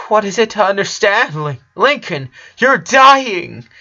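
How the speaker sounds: high, loud, fast accusing cry; anguished anger. Acted. Sister furious and heartbroken at her dying brother, shouting. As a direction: hurl it at him in disbelief.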